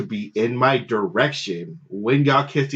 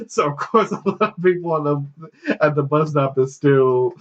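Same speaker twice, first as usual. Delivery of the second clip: normal talking voice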